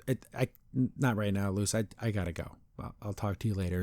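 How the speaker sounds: soft, low, halting excuse; subdued upset. Retold or imitated. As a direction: imitate someone quietly withdrawing while hurt. Upset Amity excuses herself, retold.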